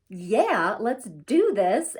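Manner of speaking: psyched, ready to charge ahead; pumped up. Retold or imitated. Pumped-up inner voice quote.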